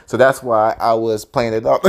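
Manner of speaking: normal talking voice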